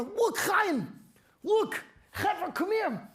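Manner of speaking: high, urgent calling to a friend. Acted. Man calling Chaim to come see, high urgent call.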